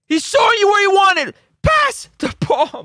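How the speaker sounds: frustrated shout. Real, not acted. Fan yelling at the replay, fed-up shout. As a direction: shout at the screen for him to pass.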